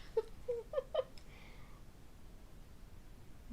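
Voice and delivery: helpless high giggling; high airy giggles; giggle fit. Real, not acted. Laughing hard at accent joke.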